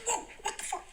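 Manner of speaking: high-pitched startled squeak, half laughing; retold shock. Storyteller reliving a shock in the street, high startled voice. Retelling her own shocked reaction.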